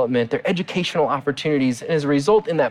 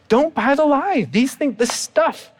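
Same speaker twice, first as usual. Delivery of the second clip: emphatic warning; 9 semitones higher, slower than usual. Real, not acted. Preacher's climactic warning.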